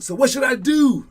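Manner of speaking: mock high player voice; high imitating player. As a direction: squeak it like the player asking. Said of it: "he was like ... like that".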